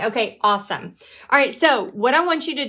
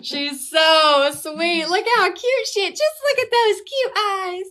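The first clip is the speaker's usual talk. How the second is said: doting dog voice, much higher; cooing to the dog; 8 semitones higher than usual. Real, not acted. Cooing over her dog.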